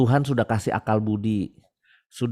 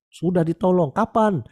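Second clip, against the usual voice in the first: surprised question; 7 semitones higher than usual. Retold or imitated. Hermit asks surprised in dialogue.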